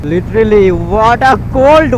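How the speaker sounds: loud high cry about the cold; cold exclamation. Real, not acted. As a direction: shout out how freezing it is. Shivering in cold on bike.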